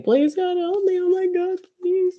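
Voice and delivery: very high, squeaky mock begging; mock pleading. Imitating own habit of begging God, mock pleading. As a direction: whine it way up high like a pestering plea. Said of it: "addicted to the bad habit of saying".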